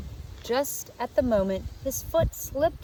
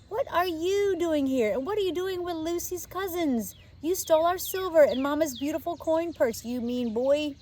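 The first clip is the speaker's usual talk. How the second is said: put-on high, accusing girl's voice; a small girl scolding the thief; indignant little girl; 9 semitones higher than usual. A narrator's reading.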